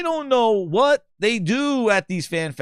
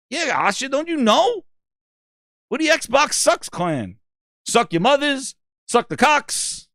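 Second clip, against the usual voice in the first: high, hammed-up clan boast; proud clan gamer; 8 semitones higher, slower than usual. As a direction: introduce the crew high and drawn out at the end.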